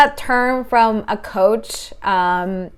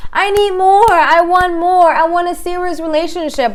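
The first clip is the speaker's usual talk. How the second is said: mock needy demand; 7 semitones higher, 4 dB louder than usual. Clingy partner demanding more.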